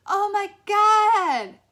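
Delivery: drawn-out high shriek. A narrator's reading. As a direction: shriek in horror. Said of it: "She shrieked".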